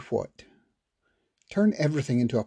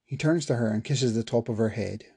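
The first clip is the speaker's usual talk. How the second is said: plain reading voice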